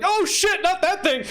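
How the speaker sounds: high, panicked yelp; acted alarm. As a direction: recoil in hammed-up horror. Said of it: "And he's like".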